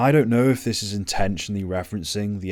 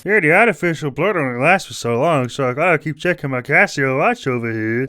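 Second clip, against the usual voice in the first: loud, higher hammed-up character voice; mock film character; 7 semitones higher, 9 dB louder than usual. Reviewer parodying the blood-drinking hero's product placement, loud mock voice. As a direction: overplay it as a shameless watch plug.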